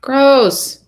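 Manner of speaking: loud, high grossed-out outburst; a daughter shooting down mom's pick; disgusted. Acted. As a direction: recoil at the very idea.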